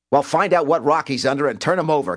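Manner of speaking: high, forceful bark; barked order. A narrator's reading. Cobb sends detectives to fetch McLean, barking. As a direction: bark orders at your squad. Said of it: "Cobb barked".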